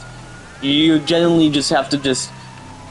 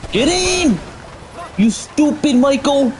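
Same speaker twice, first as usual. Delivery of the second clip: high yell at a teammate; frustrated yelling; 10 semitones higher, slower than usual. Real, not acted. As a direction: yell at Michael, slowly and high. Yelling at Michael mid-fight.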